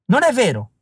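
high-pitched narrated protest; outcry of denial. A narrator's reading. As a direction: cry out in denial, voice jumping up high. Villain denies Alice's claim.

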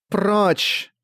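high cold command; an otherworldly voice full of anger; inhuman voice. Acted. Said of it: "её нечеловеческий, равнодушный и полный гнева голос".

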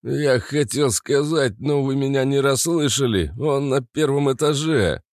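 high whiny voice; nasal whine. Acted. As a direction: whimper it out, tearful and nasal. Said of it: "плаксивым голосом сообщил Мур".

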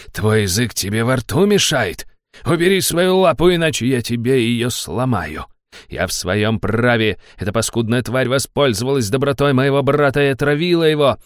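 put-on furious drunk noble yelling; angry shouting. A narrator's reading. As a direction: bark threats at him. Drunk baron shouts at hero.

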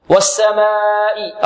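"والسماء" with the alif held four counts; four-count madd. Shown as a demonstration. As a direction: stretch the long aa of "والسماء" before the hamza. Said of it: "أربع حركات".

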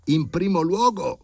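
cutting in. A narrator's reading. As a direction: echo their words back to cut them off. (ripresa lenta e beffarda, molto più acuta)

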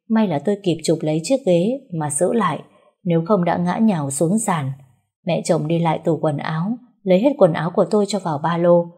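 usual speaking voice

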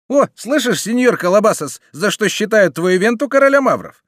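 high, loud jeering calls; mocking bandit. A narrator's reading. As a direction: taunt the innkeeper for the whole room to hear. Bandit mocks the captive noble.

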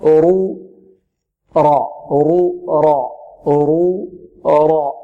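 slow, held رو and را with thick ر; heavy raa. Shown as a demonstration. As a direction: draw out رو، را with a full, heavy ر. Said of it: "الصحيح في الفتح والضم". (رو، را بتفخيم الراء ومدها)

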